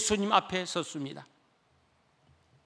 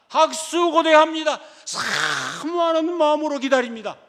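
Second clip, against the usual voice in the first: raised, higher pleading voice; loud yearning plea; 9 semitones higher, 10 dB louder than usual. Acted. Blind beggar pleading aloud to meet Jesus, loud and longing.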